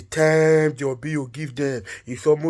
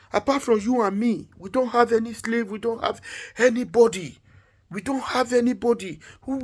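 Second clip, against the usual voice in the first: high-pitched, fretting complaint; agitated king; 8 semitones higher than usual. Acted. King frets to his wife that they have nobody, agitated and high. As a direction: worry aloud in a raised pitch, pressing the point.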